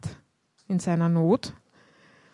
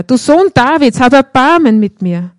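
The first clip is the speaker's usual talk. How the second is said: crying out louder; 3 semitones higher, 8 dB louder than usual. A narrator's reading. Blind man shouts louder despite the crowd, pleading. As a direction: call out even harder over the crowd. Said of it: "schrie nur noch lauter".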